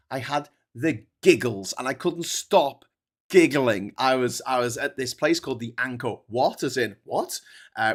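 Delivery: ordinary delivery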